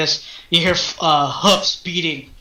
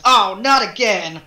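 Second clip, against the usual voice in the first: put-on exasperated girl; 3 semitones higher, slower than usual. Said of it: "she says".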